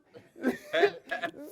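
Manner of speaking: bursting high laugh. Real, not acted. Laughs at the shenanigans.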